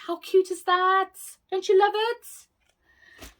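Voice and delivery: high, squealy gush over a cute find; gushing delight. Real, not acted. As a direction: coo over it like it's the cutest thing ever. Gushing over a haul item.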